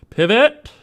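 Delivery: high, loud yelled command; mock shout. Modeller calling out a tool move, mock shout. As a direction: shout it out like an urgent order. Calls out word while modelling.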